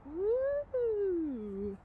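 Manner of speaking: high, bright cheer of delight; a happy little cheer as the book comes out; excited whoop. Real, not acted. Excited about showing the book.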